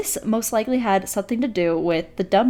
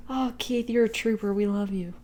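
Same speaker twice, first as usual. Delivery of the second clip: ordinary delivery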